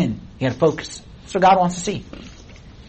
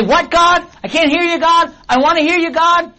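straining to hear; 9 semitones higher than usual. Pretend. Straining believer calling to God.

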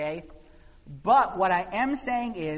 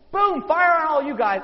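loud and high, the fire falling at last; a preacher's loud burst of excitement; excited outburst; 8 semitones higher, 10 dB louder than usual. Real, not acted. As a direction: throw the word boom out big. Climax of the fire story.

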